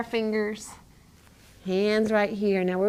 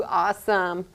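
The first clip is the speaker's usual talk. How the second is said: normal talking voice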